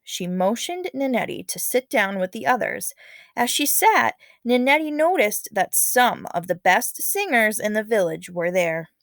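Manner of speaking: usual speaking voice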